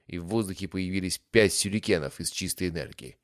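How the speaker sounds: ordinary delivery, nothing marked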